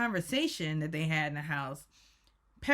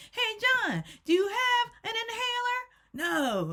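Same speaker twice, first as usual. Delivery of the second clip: high-pitched singsong call to someone; mock calling out. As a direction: call out high, imitating Tamara testing John. Acted calling out to runner.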